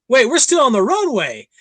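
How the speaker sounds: retold shock at still being on the runway; startled realization. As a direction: blurt it out as it dawns on you. Realizes plane hasn't left.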